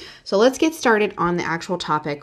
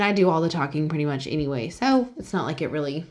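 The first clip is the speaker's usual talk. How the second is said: ordinary talk, nothing marked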